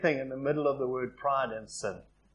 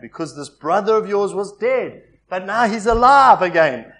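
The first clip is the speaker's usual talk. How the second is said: loud, rising rejoicing; a father pleading, swelling with joy; joyful father; 3 semitones higher, 12 dB louder than usual. Retold or imitated. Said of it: "the father said".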